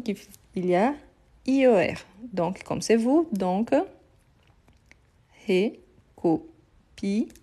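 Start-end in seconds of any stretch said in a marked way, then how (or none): 5.5-7.3 "recopier" very slowly, pausing between syllables; syllable-by-syllable dictation. Shown as a demonstration. Teacher writing out recopier for the class, slow syllable-by-syllable dictation. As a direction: break "recopier" into long, separate syllables.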